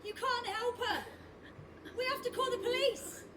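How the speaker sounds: high, distant cry of alarm; panicked cry. Acted. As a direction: plead in panic, very high, as if from away. Panicked scene after mother's collapse.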